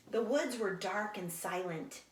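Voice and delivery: plain reading voice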